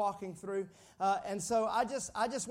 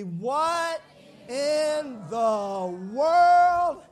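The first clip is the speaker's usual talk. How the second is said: louder, higher, very drawn-out title call; loud call-and-response; 4 semitones higher, 10 dB louder, much slower than usual. Real, not acted. Preacher leading the congregation in the series title, loud and drawn out. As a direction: stretch each word and project it to the crowd.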